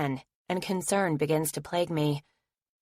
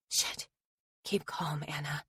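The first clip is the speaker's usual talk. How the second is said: hushed, breathless whisper to herself; frightened whisper; 9 dB quieter than usual. Acted. Anna talking herself down while stalked, in a scared whisper. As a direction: whisper to steady her nerves. Pep talk while being followed.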